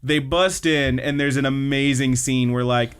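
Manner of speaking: normal talking voice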